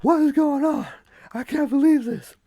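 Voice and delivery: high, loud winded disbelief in broken bursts; out of breath, huffing. Acted. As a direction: gasp it out between breaths after losing a race. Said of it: "he's just out of breath, huffing and puffing".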